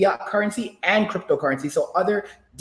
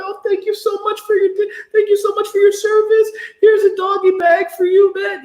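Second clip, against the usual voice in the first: much higher, louder grateful voice; mock waitress voice; 13 semitones higher, 3 dB louder than usual. Denny's server thanking a one-armed veteran, mock gushing. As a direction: gush like an overly sweet server. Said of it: "we're like".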